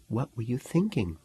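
soft, hushed question; quiet whisper to a classmate during a lecture. A narrator's reading. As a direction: lean in and ask under your breath. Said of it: "Mary whispered".

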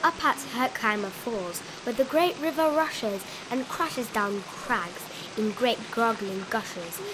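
plain reading voice

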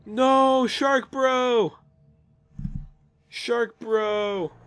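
playful mock lament; mock grief wail. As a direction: mourn Shark Bro in mock despair. Mourning dead shark companion.

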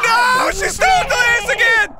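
high, loud cry of dismay; outraged shout. Real, not acted. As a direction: yell in frantic protest. Monster steals again.

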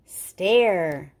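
plain reading voice

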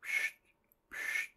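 tiny voiceless sleeping puffs; little mouse snore, hushed; soft snoring. A narrator's reading. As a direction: puff out two very quiet snores. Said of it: "snoring softly".

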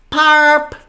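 loud, high honk; deafening parp. A narrator's reading. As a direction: blurt out a sudden high parp. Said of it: "there was a deafening sound".

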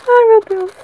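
high, loud cry of delight; real thrilled outburst; overwhelmed excitement. Real, not acted. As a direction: exclaim as if you can't contain it. Overwhelmed while opening the second book.

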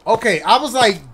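ordinary delivery

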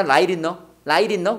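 "light in 너" said as "라이린너", T tapped. Shown as a demonstration. Teacher drills the sound for students, flapped T pronunciation. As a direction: tap the T in "light" like ㄹ, running into "in 너". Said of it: "이거 리을로 발음한다".

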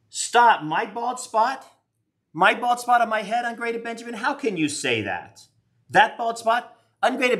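high, drawn-out indignant disbelief; mock offended. Reacting to a viewer's bald spot remark, high mock outrage.

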